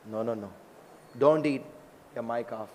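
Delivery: soft, low mild objection; lightly acted protector, quietly firm; gentle forbidding. Krishna gently forbids the tiger.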